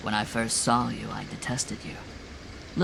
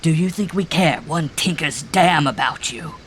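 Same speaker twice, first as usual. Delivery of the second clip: loud, higher-pitched shout; 6 semitones higher, 7 dB louder than usual. A narrator's reading. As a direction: shout it at his face. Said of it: "he shouted".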